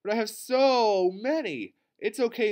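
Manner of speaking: high, loud, stretched-out protest; eager insistence. A narrator's reading. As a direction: insist like a keen child, drawing it out. Child insists to teacher.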